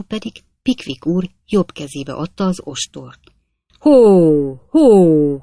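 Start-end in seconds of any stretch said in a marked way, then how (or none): 3.8-5.4 loud call to the horse; shouting whoa. A narrator's reading. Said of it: "kiáltott fel Pickwick úr". (hangos kiáltás a lónak)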